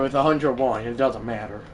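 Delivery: usual speaking voice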